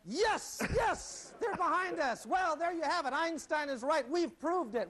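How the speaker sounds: high, excited proclamation; mock triumph. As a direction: announce the breakthrough as if history were being made. Mock triumph at staged experiment's result.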